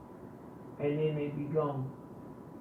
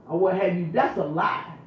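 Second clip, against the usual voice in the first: ordinary talk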